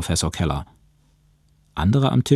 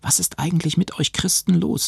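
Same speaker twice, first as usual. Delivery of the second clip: much higher-pitched, irritated question; rising anger; 11 semitones higher than usual. A narrator's reading. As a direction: challenge them with anger held in check. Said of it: "stieg wieder die Wut hoch". (aufsteigende Wut)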